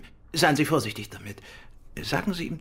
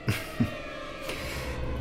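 soft knowing chuckle; 7 dB quieter than usual. Acted. Knowing laugh at question.

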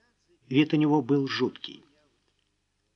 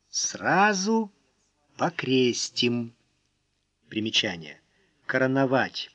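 ordinary delivery

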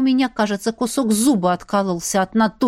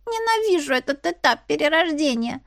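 much higher, squeaky little grumbler; squeaky kitten voice; 9 semitones higher than usual. Acted. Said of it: "писклявым голосочком".

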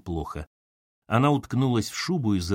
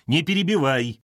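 firm, higher-pitched command; 6 semitones higher, 4 dB louder than usual. A narrator's reading. Mother stops daughter interrupting, commanding. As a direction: stop the interruption with authority. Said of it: "властно заявила мать".

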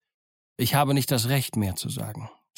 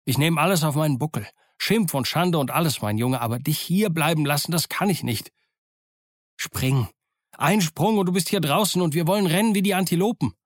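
higher, impassioned plea; urgent urging; 5 semitones higher than usual. A narrator's reading. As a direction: press the boy to make a run for it. Doctor urges Jim to flee. (eindringlich drängend)

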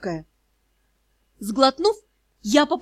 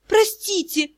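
high, plaintive apology; pleading apology; 7 semitones higher, slower than usual. A narrator's reading. Ashamed student begs the cursed magister, pleading. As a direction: plead in a small, raised voice.

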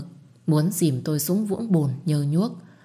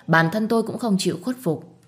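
usual speaking voice

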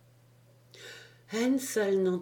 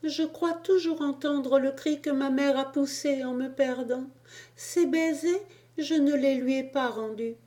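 put-on high, tearful child's voice pouring out grief; a little boy begging through his sorrow; high-pitched child pleading, speeding up at the end; grieving cherub child; 7 semitones higher than usual.

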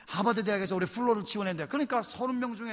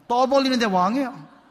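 loud, high burst of emphasis; emphatic exclamation; 4 semitones higher, 8 dB louder than usual. Real, not acted. Pastor telling the crowd about a boastful man, emphatic.

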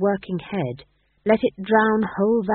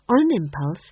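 usual speaking voice

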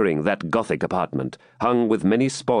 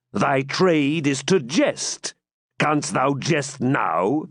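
raised, slow, threatening knight's voice; menacing Norman baron; 6 semitones higher than usual. Acted. Baron threatening Saxon prisoners with hanging, slow menace. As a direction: draw out each threat to the captives.

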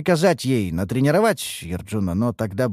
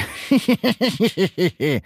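higher-pitched, drawn-out teasing chuckle; acted chuckle; 7 semitones higher than usual. Master amused by the elf's taunt, chuckling. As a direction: laugh off the jibe. Master laughs at her jibe.

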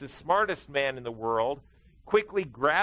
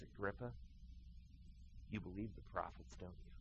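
quiet appeal; 5 semitones lower, 18 dB quieter, faster than usual. Retold or imitated. Paul appeals to Agrippa, first take.

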